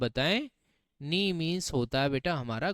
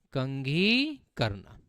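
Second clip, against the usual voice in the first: कंघी करना drawn out slowly; slow, stressed; 4 semitones lower, 3 dB quieter, much slower than usual. Shown as a demonstration. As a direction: spell out the meaning slowly. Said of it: "क्या हो गया बेटा हमारा". (धीरे से अर्थ बताना)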